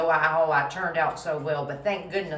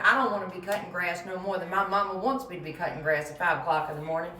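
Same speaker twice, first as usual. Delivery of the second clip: normal talking voice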